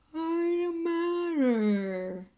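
high, very slow and drowsy, just woken; groggy. A narrator's reading. Harry woken at dawn, groggy and slow. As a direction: drag the words out half asleep. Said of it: "said Harry groggily".

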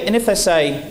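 ordinary talk, nothing marked

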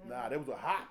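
normal talking voice

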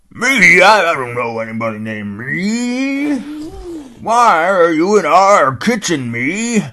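put-on puzzled old dog grumbling; old hound dog. Old hound dog puzzling over the name, loud and folksy.

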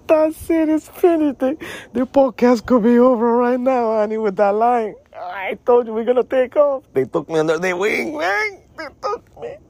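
high squealing words through laughter; can barely speak for laughing; shrieking high with laughter; laughing hysterically. Real, not acted. Cracks up over the line.

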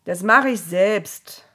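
loud, slow grumble; gruff, emphatic retort; growled. A narrator's reading. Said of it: "knurrte Gott".

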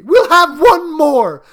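high, loud, exultant command; frenzied, elated cry; triumphant. A narrator's reading. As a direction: urge it in wild triumph. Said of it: "she cried triumphantly".

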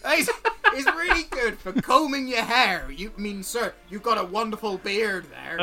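lightly voiced scruffy salesman; a fawning trader reeling off his wares; stall merchant. GM as merchant selling tools to the party, lightly voiced.

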